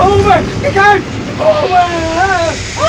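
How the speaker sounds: high, full-force shout of alarm; terrified scream. Acted. Passenger at the moment of impact, screaming in terror. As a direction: scream the warning as the crash comes.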